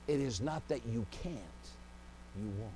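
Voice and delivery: preacher delivering the hard truth quietly; hushed punchline. Acted. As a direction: drop to a near whisper on you won't. The same punchline repeated softly.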